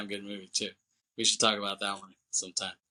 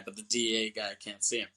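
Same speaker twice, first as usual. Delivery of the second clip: usual speaking voice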